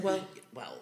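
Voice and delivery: whispered knowing aside; hushed well well. Retold or imitated. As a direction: breathe it like a secret. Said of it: "He said".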